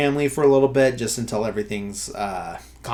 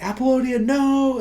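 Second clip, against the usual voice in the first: desperate shout; 14 semitones higher than usual. Retold or imitated. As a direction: yell her name to stop her. Michael calls out as car explodes.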